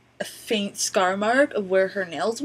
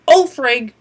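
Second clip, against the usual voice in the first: loud mock curse from a little old lady; Martha swearing; 5 semitones higher, 14 dB louder than usual. Old lady cursing at the excluded coupon, loud. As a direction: blurt it out to the whole line. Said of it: "Just like that".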